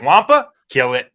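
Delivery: imitating Luke. Podcaster mocks Luke meeting creatures, mock killer. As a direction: punch it out as a comic kill order. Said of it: "Luke's, like, sees a big creature, he's like".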